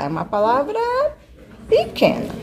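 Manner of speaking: high-pitched teacherly "pequena"; teacherly prompt. Shown as a demonstration. Sounding out for the pupil.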